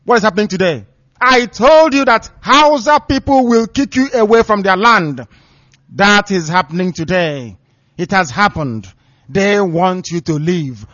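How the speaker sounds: loud, rising preaching voice, hammering dates; fiery preaching. Acted. Broadcaster warning listeners, loud preaching. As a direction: preach with mounting fervour.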